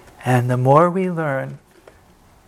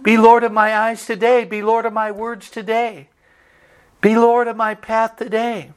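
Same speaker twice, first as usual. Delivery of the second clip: raised pitch, earnest prayer lines; prayerful recitation; 7 semitones higher than usual. Acted. Preacher reciting a prayer to the congregation, earnest.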